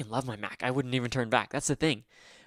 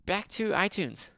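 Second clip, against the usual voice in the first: high, slow, drawn-out voice; high-pitched voice; 7 semitones higher, 3 dB louder, slower than usual. Pretend. Sudden shift to a high voice.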